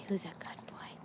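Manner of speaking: soft whispered praise to the dog. Real, not acted.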